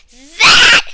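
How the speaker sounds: loud short shout over noise; girl screaming and pointing. A narrator's reading. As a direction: yell it over the din. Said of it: "I screamed over the noise".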